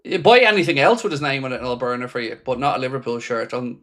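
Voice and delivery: ordinary delivery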